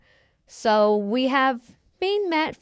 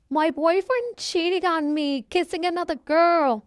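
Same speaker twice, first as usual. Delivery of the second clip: high-pitched mock distress, no tears; upset daughter, crying; 7 semitones higher than usual. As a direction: wail it to mom, acted out. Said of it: "slammed the door and cry".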